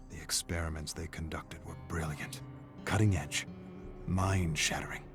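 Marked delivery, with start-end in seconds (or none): none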